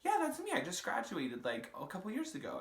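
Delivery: lightly voiced, high breezy guy; a hint of a smug stranger; mock guy voice. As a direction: play the casual liar, light and high. Mimicking the catfisher's reply.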